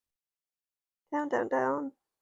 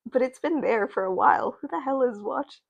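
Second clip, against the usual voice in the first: ordinary talk, nothing marked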